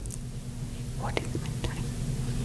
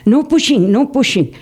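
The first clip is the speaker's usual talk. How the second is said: calling out no pushing; 8 semitones higher than usual. Retold or imitated. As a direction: call out firmly to a pushing crowd. Baba calling out to crowd.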